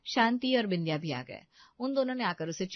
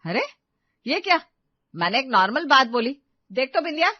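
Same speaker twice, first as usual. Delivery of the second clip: loud, high-pitched bewildered protest; taken aback; 6 semitones higher, 9 dB louder than usual. Acted. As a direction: react startled to the sudden crying. Mother-in-law taken aback by crying.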